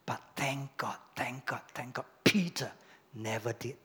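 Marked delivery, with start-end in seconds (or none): 0.1-2.1 soft whispered relief; whispered thanks. Real, not acted. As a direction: breathe the thanks quietly. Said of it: "relieved thanks".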